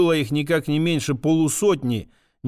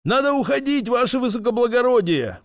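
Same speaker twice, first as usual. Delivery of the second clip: high, urgent shout to the master; 8 semitones higher than usual. A narrator's reading. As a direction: yell it out in alarm. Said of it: "прокричал водитель".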